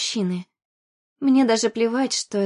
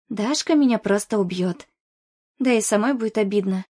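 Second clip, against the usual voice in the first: ordinary delivery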